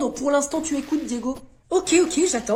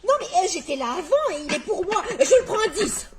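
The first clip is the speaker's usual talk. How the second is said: laughing bid; 5 semitones higher than usual. Acted. Customer outbidding a rival, laughing through it. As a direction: laugh while you outbid.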